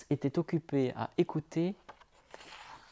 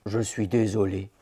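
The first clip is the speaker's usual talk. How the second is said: sorry wolf; 6 semitones lower than usual. A narrator's reading. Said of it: "dit le loup".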